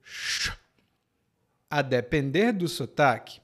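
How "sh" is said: "sh" as a bare hush; "sh" with no vowel; sh sound. Shown as a demonstration. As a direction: sound out "sh" alone. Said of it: "ou de sh".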